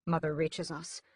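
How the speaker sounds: plain reading voice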